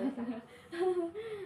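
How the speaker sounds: a quick, bright burst of laughter; short laugh. Real, not acted. Counselor laughing as the client wants to start right away, short laugh. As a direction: laugh it off, caught off guard.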